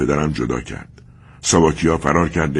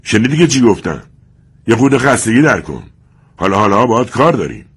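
loud, higher taunting sneer; mocking tone; 6 semitones higher, 6 dB louder than usual.

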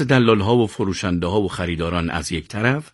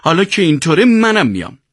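high-pitched, aggrieved whine of protest; resentful; 10 semitones higher, 4 dB louder than usual. A narrator's reading. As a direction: complain about favouritism, hurt and insistent. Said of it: "سیاوش با دلخوری گفت". (اعتراض دلخورانه با صدای زیر)

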